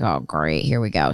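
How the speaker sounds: low, deadpan "oh great"; sarcastic resignation. Retold or imitated. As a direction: drop the voice in dry sarcasm. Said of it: "and I'm like".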